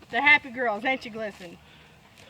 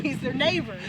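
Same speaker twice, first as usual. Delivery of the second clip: normal talking voice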